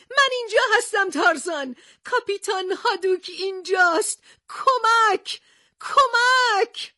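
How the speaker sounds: very high, loud cries for help, last one drawn out; joyful calling out. Acted. Captain calling to approaching Tarzan, loud high cries. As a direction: call to Tarzan at the top of your voice. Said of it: "با خوشحالی ... فریاد کشید".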